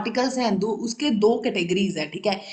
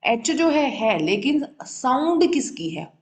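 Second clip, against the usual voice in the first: ordinary delivery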